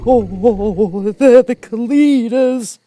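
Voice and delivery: high, loud, slow terrified wail; frightened trembling. A narrator's reading. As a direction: cry out in panic at the beasts. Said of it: "beginning to tremble".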